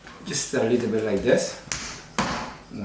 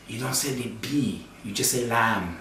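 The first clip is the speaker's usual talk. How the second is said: softer, "Lamb" with a silent B; gentle correction; 7 dB quieter than usual. Retold or imitated. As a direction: quietly set him right. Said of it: "this parishioner says".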